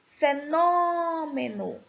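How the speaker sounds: "Fenômeno" with NÔ held long and high; stress on NÔ. Shown as a demonstration. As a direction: stretch the second syllable of "Fenômeno". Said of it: "o nó vai ser a sílaba tônica".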